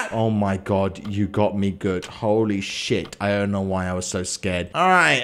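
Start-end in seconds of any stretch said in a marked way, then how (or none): none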